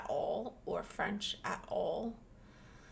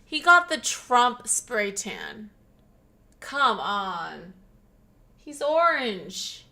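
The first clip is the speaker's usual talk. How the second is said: louder, teasing exclamation; loud teasing about the spray tan; loud mocking jab; 7 dB louder than usual. Real, not acted. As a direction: roast his orange tan.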